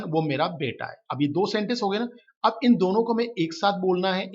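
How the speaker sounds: ordinary talk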